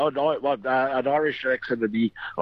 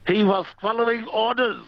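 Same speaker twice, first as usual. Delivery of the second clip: lightly put-on, high-pitched punchline delivery; joke punchline voice; 12 semitones higher than usual. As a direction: deliver the joke's answer much higher. Punchline of German swan joke.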